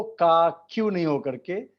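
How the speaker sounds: ordinary talk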